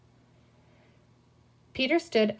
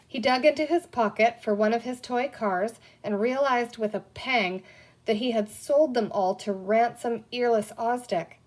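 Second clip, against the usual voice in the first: usual speaking voice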